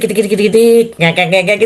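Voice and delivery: rattling, creaking freight lift imitation; quick repeated mechanical clatter sounds; imitated lift noise. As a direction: mimic the lift clattering as it climbs. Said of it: "itu bunyinya seperti itu ya".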